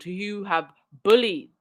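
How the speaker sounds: ordinary delivery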